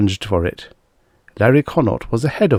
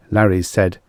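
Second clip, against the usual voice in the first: ordinary delivery, nothing marked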